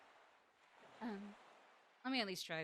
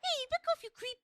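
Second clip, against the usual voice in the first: cartoon princess. Voicing character in game.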